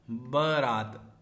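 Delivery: बारात drawn out slowly; teacher says बारात very slowly; slow spelling demo. Shown as a demonstration. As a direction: stretch बारात syllable by syllable. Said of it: "बारात नहीं होता है".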